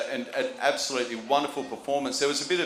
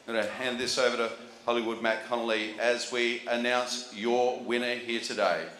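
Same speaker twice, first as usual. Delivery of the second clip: normal talking voice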